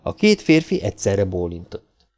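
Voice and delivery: ordinary delivery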